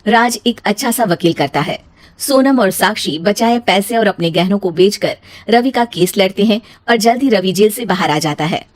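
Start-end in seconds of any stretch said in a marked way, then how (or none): none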